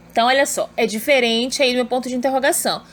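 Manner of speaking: normal talking voice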